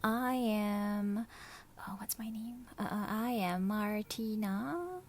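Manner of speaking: soft, whispered stammer; flustered whisper. Acted. Martina stammers her name to Perez in a shy whisper. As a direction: lose your words, shyly.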